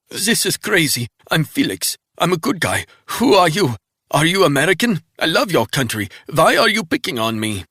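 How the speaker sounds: high, loud, desperate begging; panicked pleading. Acted. Wounded man pleading with Resnick not to kill him. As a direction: beg for your life, frantic.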